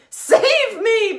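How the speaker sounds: high, loud scream for help; scared sugar glider screaming for help; panicked cry. Acted. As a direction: shriek in terror. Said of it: "Bismarck cried".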